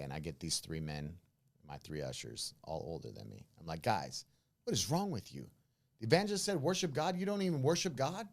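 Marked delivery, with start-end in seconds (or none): 3.8-8.3 higher, louder retold scolding; going off on them. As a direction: pastor lays into his older ushers with indignant questions. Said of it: "I'm going off on them".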